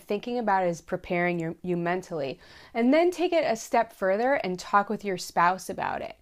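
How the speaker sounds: usual speaking voice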